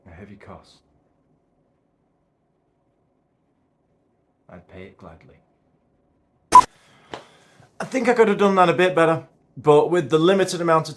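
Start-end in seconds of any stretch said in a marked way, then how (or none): 0.1-5.4 low, very soft, resigned film monologue; hushed and deep, with long heavy pauses; doomed man's monologue. Acted. Performs Equilibrium monologue to camera.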